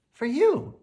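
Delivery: put-on high, eager child's reply; little girl voice. A narrator's reading. Sophia answering her neighbour in a high, eager child's voice. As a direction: hand it over like a proud little girl. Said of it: "answers Sophia".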